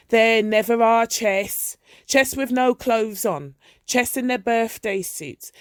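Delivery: an old mother mocking her son's magazines through the door; jeering mother. A narrator's reading. Mother shouting accusations at night.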